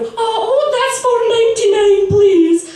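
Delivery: very high, loud Scottish cashier; shrieky high Scottish shop patter; put-on Scottish accent. Shown as a demonstration. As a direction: chirp it loudly in exaggerated Scottish. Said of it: "I made you think I was Scottish".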